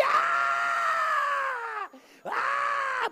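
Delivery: very high, loud mock shrieks; long repeated screams; piercing scream. Woman drowning out the pastor, piercing mock screams.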